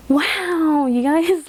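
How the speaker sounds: high, loud amazement; amazed. Real, not acted. Vlogger amazed by her cash total, tells viewers, astonished. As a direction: gush in real astonishment to your viewers.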